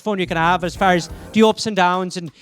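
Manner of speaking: chatty Irish pub-talk accent. Shown as a demonstration. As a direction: hit the D's and T's in Irish. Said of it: "obviously it's fun to be Irish".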